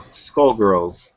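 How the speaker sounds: usual speaking voice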